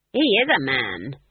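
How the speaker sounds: slow, loud contradiction; blunt old witch verdict; firm objection. A narrator's reading. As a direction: state it emphatically, slowly. Granny contradicts after analytical stare.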